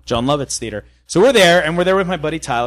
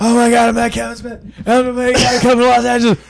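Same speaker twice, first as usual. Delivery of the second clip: put-on excited drunk call; 6 semitones higher than usual. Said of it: "you were like".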